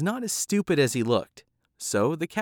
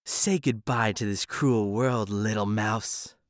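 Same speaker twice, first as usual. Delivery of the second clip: low, slow villainous cat; menacing cat; 4 semitones lower, slower than usual. Acted. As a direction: purr a threat slowly. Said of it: "replied the cat".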